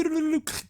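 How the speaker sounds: a short high laugh at the silly joke. Real, not acted. Viewer reacting to a silly gag, laughing. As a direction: burst of real laughter.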